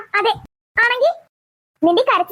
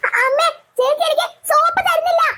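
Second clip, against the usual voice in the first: put-on higher, tattling child voice; whiny complaint; 7 semitones higher than usual. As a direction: tell on the sister to mum.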